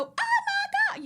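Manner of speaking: squealed high, drawn-out gasp of delight; oh my God. Retold or imitated. Recipient opening a gift, squealing with delight. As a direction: shriek it like opening a dream gift. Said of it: "and you go".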